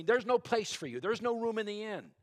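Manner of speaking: gruff innkeeper barking no room; harsh refusal. A narrator's reading. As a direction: slam the door on them with your voice. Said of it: "William said kind of harshly".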